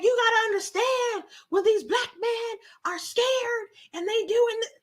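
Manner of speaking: high whispery put-on voice; mocking squeak of a voice; imitating the girl excusing rioters, high and whispery; imitating her. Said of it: "that's how she sounded".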